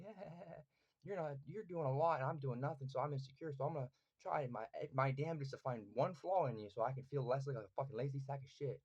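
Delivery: high, pushed imitation of an insecure heckler; mocking critic. Voicing insecure critics hunting flaws in him, mocking. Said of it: "when motherfuckers try to sit there".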